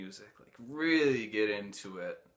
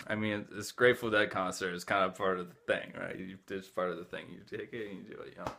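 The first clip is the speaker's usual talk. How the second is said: usual speaking voice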